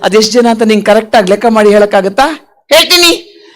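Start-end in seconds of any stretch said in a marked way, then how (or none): 2.7-3.4 higher-pitched puppet voice; cheeky puppet. Acted. Puppet Kitty promises the host a count, cheeky puppet voice. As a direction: answer as a cocky little puppet.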